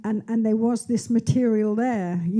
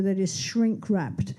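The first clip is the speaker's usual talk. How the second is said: normal talking voice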